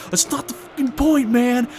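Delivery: high, strained whiny fury in a put-on voice; frustrated rant. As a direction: voice the guy ranting that he's so mad. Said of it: "And he's just like".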